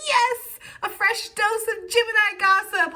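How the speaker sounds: high, loud, slow, relishing gossip voice; delighted gossip-hungry crowd; gleeful gossiper. Retold or imitated. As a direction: savour the juicy gossip.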